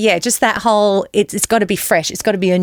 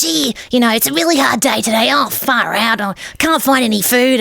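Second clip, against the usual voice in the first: higher-pitched improvised bug character; weird little bug; 5 semitones higher than usual. Acted. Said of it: "got this weird bug and you're like".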